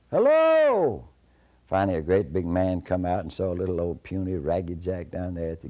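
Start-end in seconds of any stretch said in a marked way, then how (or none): 0.1-1.0 high, loud holler falling at the end; long-distance call with a falling tune; hollered hello. Acted. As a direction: holler HELLO drawn out, high then dropping off. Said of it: "He hollered hello at the house".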